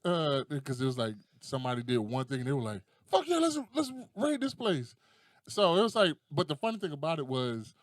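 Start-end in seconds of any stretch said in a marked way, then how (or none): 3.1-4.9 high, eager exclamation; rally the crowd in a raised voice; mock rallying cry. People egging each other on to raid, mock excited.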